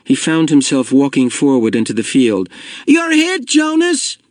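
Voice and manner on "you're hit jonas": high-pitched playground yell. A narrator's reading. Asher to Jonas from behind a tree, yelling. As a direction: yell it out in the thick of play. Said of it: "Asher yelled from behind the tree".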